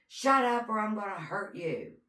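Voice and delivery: retelling a kidnapper's menacing yell; imitated threat. Attacker threatening the crying girl.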